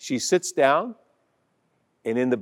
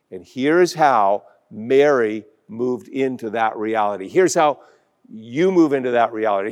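ordinary talk, nothing marked

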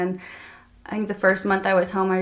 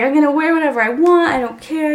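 higher, louder, carefree defiance; high, loud, couldn't-care-less mimicry of her younger self; defiant mock retort; 8 semitones higher, 11 dB louder than usual. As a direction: toss it off like a stubborn teen. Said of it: "I was always like".

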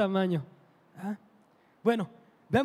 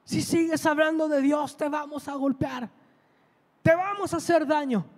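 amenaza airada en tono alto, contenida; grito imitado; 5 semitones higher than usual. Retold or imitated. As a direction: imita a una señora que manda callar, sin gritar de verdad. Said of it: "le empieza a gritar". (grito fingido de señora furiosa)

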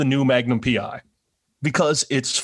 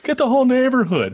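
put-on much higher, louder kid voice; excited kid; 12 semitones higher, 4 dB louder than usual. Teasing his cohost, as a child rallying the neighbours. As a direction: yell it like a thrilled child.

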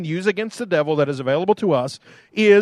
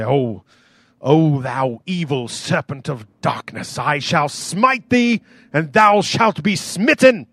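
put-on English accent; 3 semitones lower than usual. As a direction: thunder a mock-biblical threat in an English accent. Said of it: "he's got an English accent".

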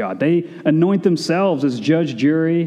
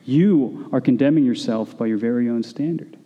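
ordinary talk

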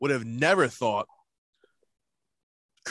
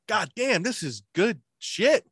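higher, emphatic retold exclamation; amazed delight; 8 semitones higher, 3 dB louder, slower than usual. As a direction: relive being blown away by the taste. Retelling first taste of jam.

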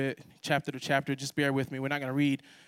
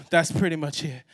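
ordinary delivery

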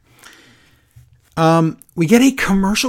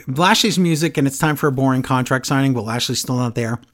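ordinary talk, nothing marked

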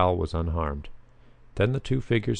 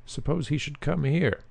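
alarmed shout; 8 semitones higher than usual. A narrator's reading. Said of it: "shouted Frank".

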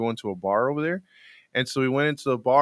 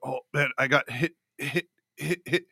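Tony; 6 dB louder than usual. Retold or imitated. Retelling a stuttering friend emphasizing his point, stutter into a shout. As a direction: repeat the stuck word, then punch the ending. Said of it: "if he emphasizes something".

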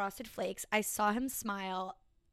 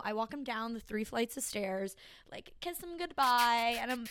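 normal talking voice

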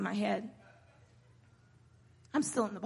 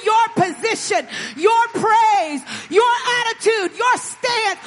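loud, high, building pulpit cry; impassioned revival preaching, raised and forceful; full-force preaching; 10 semitones higher, 10 dB louder than usual. Real, not acted. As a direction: hammer each phrase at the congregation.

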